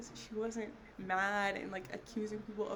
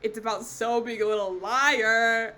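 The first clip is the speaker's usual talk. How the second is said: loud tease; 12 dB louder than usual. Acted. Teasing accusation.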